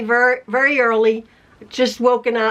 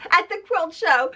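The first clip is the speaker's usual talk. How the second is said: laughing; 4 semitones higher than usual. Real, not acted. Laughing at the idea.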